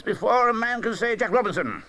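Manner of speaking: high, hearty calling voice; a fat jolly master hailing his apprentices; jovial Fezziwig. A narrator's reading. Fezziwig calling his apprentices, jovial and hearty. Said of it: "cried old Fezziwig".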